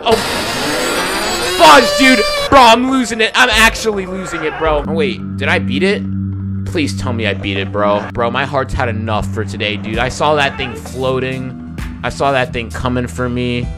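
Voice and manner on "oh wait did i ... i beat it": ordinary talk, nothing marked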